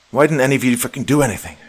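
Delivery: yelling. A narrator's reading. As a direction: explode at them in a rush. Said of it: "I yelled".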